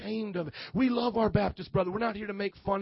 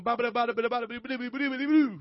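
rapid babbled syllables climbing in pitch; mock tongues babble; 3 semitones higher, much faster than usual. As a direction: playfully fake tongues, rising to the end. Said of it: "that's not my tongue".

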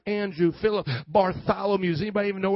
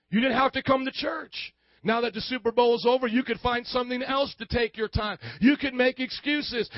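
usual speaking voice

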